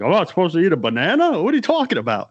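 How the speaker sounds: high-pitched incredulous questions; baffled, voice shooting way up; clueless bewilderment. Retold or imitated. Accused angler with no idea about bananas, baffled protest. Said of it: "The guy had no idea".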